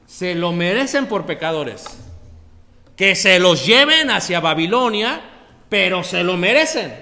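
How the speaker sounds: loud rebuke from the pulpit; loud preaching. Acted. Preacher voicing Jehovah's rebuke to Rachel, loud. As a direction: hammer home that they deserve it.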